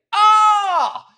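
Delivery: sudden loud cry of dismay; surprised shout. Real, not acted. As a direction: yelp out in surprise. Reacts to viewer's absence.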